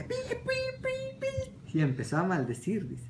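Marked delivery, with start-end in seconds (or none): 0.0-1.8 high mock censor bleeps for the curse words; bleep censor noise. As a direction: bleep out the swearing, squeaky and high.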